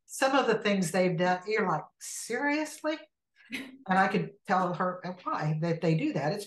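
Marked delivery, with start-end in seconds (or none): 2.0-3.0 high, drawn-out disbelief; softly pitched-up, questioning disbelief; incredulous. Acted. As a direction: say it like you can't believe they did that. Disbelief at coworkers' behaviour.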